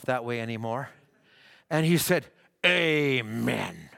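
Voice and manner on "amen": long, falling, lower-pitched amen; hippie's amen. Retold or imitated. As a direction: draw it out as a comic agreement. Hippie's answer to the condemnation.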